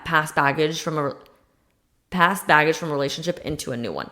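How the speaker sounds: ordinary talk, nothing marked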